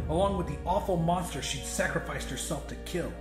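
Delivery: ordinary delivery, nothing marked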